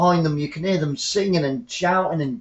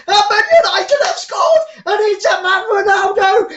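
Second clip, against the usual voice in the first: roaring goal commentary, voice cracking with excitement; goal celebration shout; 11 semitones higher than usual. Real, not acted. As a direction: scream the goal call with real joy. Goal scored, live commentary.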